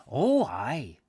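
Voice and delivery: emphatic Scots assent; broad Scottish priest; Scottish accent. Acted. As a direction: confirm it in a put-on Scottish voice. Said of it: "I can't do the accent very well".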